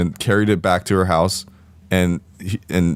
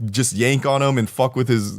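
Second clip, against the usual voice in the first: normal talking voice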